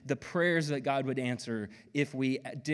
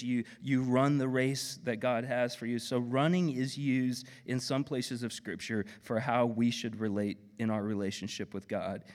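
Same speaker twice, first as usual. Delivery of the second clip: normal talking voice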